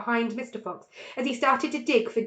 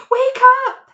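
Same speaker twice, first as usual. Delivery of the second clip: much higher, louder alarm shout; 16 dB louder than usual. A narrator's reading. Mr Fox waking his family as shovels dig, shouting. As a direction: yell to wake the family. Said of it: "he shouted".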